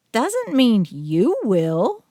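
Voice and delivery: Hazel's voice, drawn-out, dry and mocking; deadpan the joke about dead owners; slow, teasing character voice; Hazel, sarcastic. A narrator's reading. Hazel finishing sarcastic quip.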